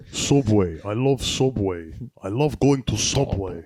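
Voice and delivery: mock Russian accent, punched out; attempted Russian accent. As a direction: try out a thick, clumsy Russian accent. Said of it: "What's a Russian accent?".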